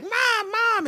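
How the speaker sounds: both sisters yell for mom; calling Mom. Retold or imitated. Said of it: "they're like".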